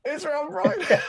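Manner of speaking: high, loud laughing squeal; laughing shock. Retold or imitated. Recognising Rob Brydon again, a loud high laughing squeal.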